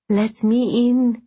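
high, slow, gasping plea; gasping ghost. Acted. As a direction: moan it like a freezing ghost. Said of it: "it gasped".

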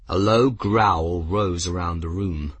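usual speaking voice

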